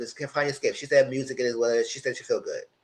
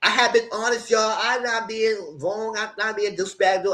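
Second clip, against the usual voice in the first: higher mock defensive whine; a hint of a whiny guy; mock Sincere; 8 semitones higher than usual. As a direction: imitate his pleading excuse. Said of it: "he said, in confessional".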